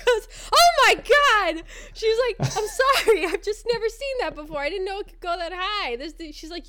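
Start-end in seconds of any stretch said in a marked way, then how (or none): 0.5-1.6 loud, high, drawn-out cry of shock; stretched, high-pitched shocked outburst; freaked-out nurse. Retold or imitated. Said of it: "your nurse kind of freaked out". 2.6-6.0 high-pitched flustered disbelief, loud at first; retold shock, pitched way up; freaked-out nurse. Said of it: "your nurse kind of freaked out".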